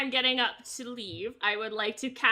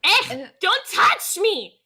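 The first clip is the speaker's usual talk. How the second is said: high, drawn-out acted outburst; indignant protest; 7 semitones higher, 4 dB louder, slower than usual. Drunk patron being shoved by halflings, indignant. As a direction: slowly push out an offended cry.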